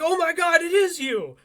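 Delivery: high, loud amazed cry; stunned recognition. Retold or imitated. Kitchen guy realizing it is Batman, loud and high. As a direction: gasp it out in disbelief.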